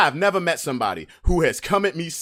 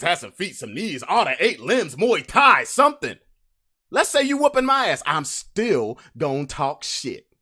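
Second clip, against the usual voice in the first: ordinary talk, nothing marked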